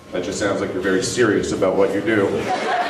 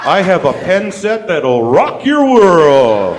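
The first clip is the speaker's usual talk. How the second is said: slow, louder hard-sell pitch; pushy telemarketer; 7 semitones higher, 9 dB louder, much slower than usual. Pretend. Telemarketer selling a pen set, slow and pushy.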